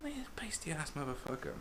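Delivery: whispered aside; cheeky hushed jab; conspiratorial whisper. Real, not acted. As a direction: lean in and whisper the insult. Conspiratorial insult.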